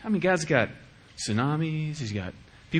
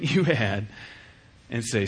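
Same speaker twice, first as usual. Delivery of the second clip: normal talking voice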